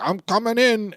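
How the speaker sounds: high, loud announcement; hammed-up forceful entry; shouting through the door before barging in; mock barge-in. Montour barges in.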